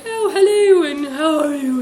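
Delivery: high, loud, badly faked British greeting; hammed-up British smoker's hello. Imitating a huge smoker coming over, badly faked British accent. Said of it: "a British accent and a smoker's voice".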